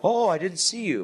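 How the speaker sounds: high, bright fake surprise; mock surprised greeting. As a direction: act like you just noticed him. Man fakes surprise at reporter.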